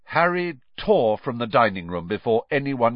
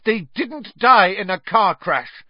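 angry retort; 10 semitones higher, 6 dB louder than usual. A narrator's reading. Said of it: "said Harry, who found himself on his feet".

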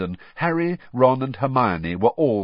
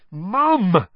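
high, loud irritated protest; irritated outburst; irritable; 10 semitones higher, 6 dB louder than usual. A narrator's reading. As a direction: call out to mum in exasperation. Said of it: "said Ron irritably".

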